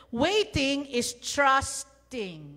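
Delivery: very slow, weighty statement; preacher driving home the main point slowly; emphatic. Acted. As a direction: lay each word down deliberately. Key sermon point stated.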